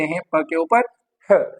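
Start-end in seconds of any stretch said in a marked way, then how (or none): 1.3-1.4 "ह" as a bare puff of breath; isolated "ह" sound. Shown as a demonstration. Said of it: "एच का जो sound है".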